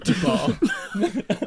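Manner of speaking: laughing cartoon Italian meatball; fake Italian accent. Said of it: "we do fake Italian accents".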